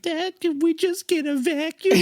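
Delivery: put-on high squeaky child voice; whiny little kid asking dad. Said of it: "baby John Wayne Gacy's like".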